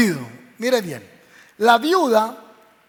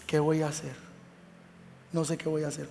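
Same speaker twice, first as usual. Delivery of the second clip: despairing murmur; 7 semitones lower, 12 dB quieter, faster than usual. Retold or imitated. Sleepless worry retold.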